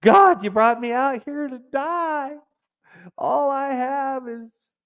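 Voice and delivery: hammed-up complaining Israelite; moaning Israelites. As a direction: wail at God like ungrateful Israelites. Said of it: "moaning and complaining like the Israelites".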